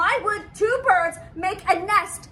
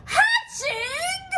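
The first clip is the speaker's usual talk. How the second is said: much higher, squeaky cry of alarm; alarmed disbelief; 10 semitones higher than usual. Acted. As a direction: shriek the word in comic panic. Alarm at hatching.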